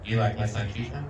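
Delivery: soft, low, creepy offer; low mock come-on. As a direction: sidle up and ask quietly. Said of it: "Raven's gonna go".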